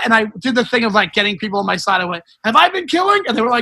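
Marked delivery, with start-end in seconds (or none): 2.4-3.2 high crowd-rousing call; rallying shout. Retold or imitated. Comic gets the crowd on his side, calling out high. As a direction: call out for the whole room's agreement.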